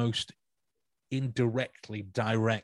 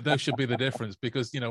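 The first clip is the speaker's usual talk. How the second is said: ordinary talk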